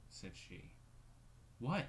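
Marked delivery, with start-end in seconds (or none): 1.6-1.9 a sharp, loud, higher snap; harsh. Acted. Caroline snapping at her sister, harsh and loud. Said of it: "asked Caroline harshly".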